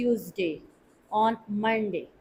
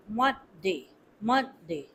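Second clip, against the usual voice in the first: Monday with the weight on Mon, day kept light and low; stress on MON; 5 semitones lower, 5 dB quieter, slower than usual. Shown as a demonstration. Teacher models stress on Mon for learners, softly. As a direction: lean on Mon and let day fall away. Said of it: "Only the stress should be on mon".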